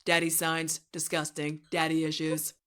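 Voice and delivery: monotone synthetic presidential impression; deadpan robotic boasting; Trump. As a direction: parody politician in an even, AI-like delivery. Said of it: "sounds like a Trump A I voice".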